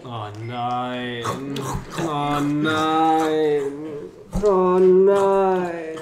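building dismay. Acted. Growing dread, repeated.

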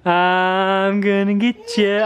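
slow, drawn-out mock threat "I'm gonna get ya"; playful menace. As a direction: stretch "I'm gonna get ya" slowly like a tickle monster. Dad chasing boys playfully.

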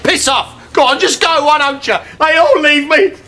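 wallow in it, half-crying; self-pitying wail. Acted. Publican alone with no staff, wailing in self-pity. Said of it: "seeing you cry".

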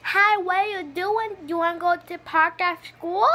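put-on high, slowed toddler babble; three-year-old voice. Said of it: "they sound like a three-year-old".

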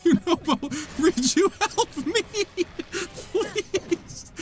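very high, squeaky begging; high, drawn-out, helpless plea with laughter; laughing plea. Real, not acted. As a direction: beg for help through giggles. Begging for help while laughing.

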